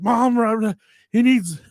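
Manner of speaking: high, loud urgent call for mom; panicked call. Retold or imitated. Kid runs inside yelling to mom for help. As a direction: yell for help, frantic. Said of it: "and I'm like".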